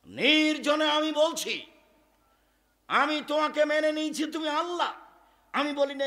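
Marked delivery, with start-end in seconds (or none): 0.0-4.9 preaching at full force. Acted. Preacher voicing a woman's prayer, loud and high. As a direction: cry it out to God.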